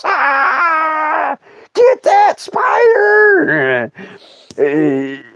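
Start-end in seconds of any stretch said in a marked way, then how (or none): 0.0-3.9 high, drawn-out panicked shriek; shrieking aunt. Acted. Aunt running to the house from a spider, shrieking. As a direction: scream it out, stretched and very high. Acting screaming aunt fleeing outhouse.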